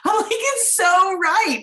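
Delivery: "It's so right" sung out high and slow; emphatic agreement. Retold or imitated. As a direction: drag out "It's so right" in delighted disbelief. Agreeing with guest's phrase, laughing.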